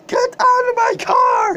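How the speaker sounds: high, sobbing scream; crying shout. Acted. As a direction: wail it at the passenger. Driver screaming at passenger.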